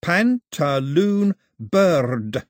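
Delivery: very slow, carefully copied; mimicking her slow teaching voice; careful repetition. A narrator's reading. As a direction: sound the words out slowly. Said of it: "She wants me to say it".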